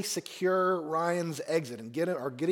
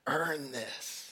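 faint, hushed last words, much softer; dying breath; 6 dB quieter than usual. Retold or imitated. Dying captain to Ryan, faint hushed last words. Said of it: "he pulls us close and he says".

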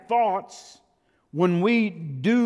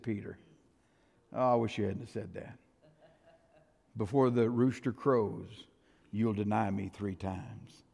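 soft and grave; 7 semitones lower, 8 dB quieter than usual. Acted. Jesus quietly warning Peter he will deny him. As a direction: say it low, sorrowful and certain.

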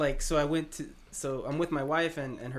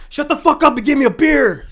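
big, high, loud shout; retold shout, belted out with gusto; yelling back rudely; 12 semitones higher, 18 dB louder than usual. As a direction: holler it like a rowdy customer at the bar. Acting out yelling back at bartender.

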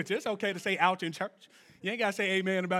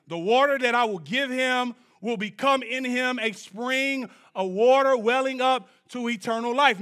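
loud, raised preaching delivery; scripture proclamation; 6 semitones higher, 8 dB louder than usual. Real, not acted. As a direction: declaim the verse to the congregation. Declaims scripture.

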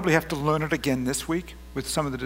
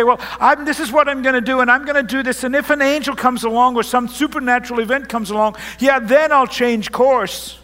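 acted headstrong insistence, high and loud; stubborn insistence; 6 semitones higher, 7 dB louder than usual. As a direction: dig in loudly on your own plan. Acting out someone set on own plan.

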